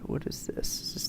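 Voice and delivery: breathy unvoiced whisper, sneaking. Real, not acted. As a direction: hush it like you're hiding from guards.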